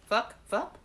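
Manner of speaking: "fup" with a short uh vowel, like pup; short-vowelled "fup", set out clearly; pronouncing fup. Shown as a demonstration. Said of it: "if it's pronounced that way".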